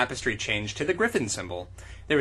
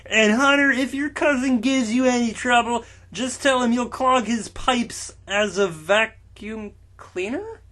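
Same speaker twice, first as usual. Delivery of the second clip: higher, slow put-on giant voice; Shogred voice; 8 semitones higher, 5 dB louder, slower than usual. Shogred warns Hunter about his cousin, hammed-up giant voice. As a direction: ham up the friendly giant.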